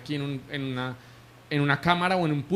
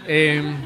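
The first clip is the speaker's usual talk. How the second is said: a short, real embarrassed laugh, louder; 4 dB louder than usual. Real, not acted. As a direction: laugh it off, caught off guard. Laughs at the question about preaching.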